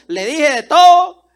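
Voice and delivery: a slow, loud, heated line; loud, slow outburst. Retold or imitated. Yelled at her daughter.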